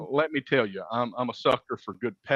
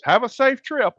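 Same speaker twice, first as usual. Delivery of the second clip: high-pitched, louder mock sing-out; chirpy clerk farewell; 12 semitones higher, 5 dB louder than usual. Hotel clerk seeing off a guest, chirpy imitation. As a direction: imitate a front-desk send-off way up high.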